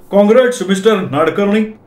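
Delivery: high-pitched hearty congratulations; congratulatory. Acted. Accountant congratulating the clerk after the visit, high hearty voice. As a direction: congratulate him with relish. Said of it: "वाघांनी माझं अभिनंदन केलं".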